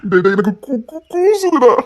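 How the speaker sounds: bashful, rising to a high squeal on கூசுதுடா; mock-coy girl voice; coy shy girl voice. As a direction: play a shy girl, coyly. Boy in woman's disguise, shy.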